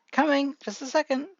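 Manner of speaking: high-pitched lightly acted mother; mother calling out. A narrator's reading. Mother answering a knock at the door, high-pitched call.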